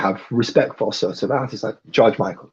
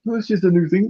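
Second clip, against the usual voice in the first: lightly voiced teenager, high; chirpy dismissive kid voice; high mock youngster; 9 semitones higher, faster than usual. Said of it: "It's like".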